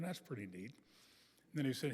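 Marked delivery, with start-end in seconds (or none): 0.0-0.7 soft, low, casual understatement; understated reply. Retold or imitated. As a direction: play it down quietly. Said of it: "I'm going".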